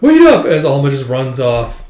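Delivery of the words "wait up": very high, loud, laughing call; calling after her. Retold or imitated. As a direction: yell after her running off. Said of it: "he's like".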